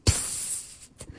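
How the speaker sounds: long whispered shush; quiet hush to silence someone; hushing psst. Acted. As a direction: hiss a soft, voiceless shush. Gusto hushes the shouting narrator.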